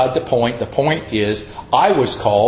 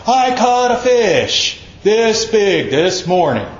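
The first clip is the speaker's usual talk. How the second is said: high, slow, drawn-out tall-tale brag; boasting fisherman; 7 semitones higher, slower than usual. Retold or imitated. Preacher imitating fishermen telling lies, mock bragging. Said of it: "and lie telling".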